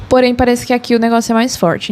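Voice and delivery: ordinary talk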